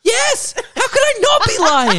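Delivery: very high, loud, laughing confession; high-pitched squeal. Real, not acted. Host admitting the fib, squealing with laughter.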